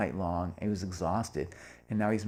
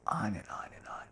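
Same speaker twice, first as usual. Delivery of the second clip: whispered, much softer; whispered aside; 8 dB quieter than usual. Real, not acted. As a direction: murmur it under your breath. Aside after the phone call.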